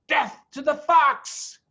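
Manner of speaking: slow, high, loud war cry; shouted. A narrator's reading. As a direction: roar it with relish. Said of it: "shouted Bunce".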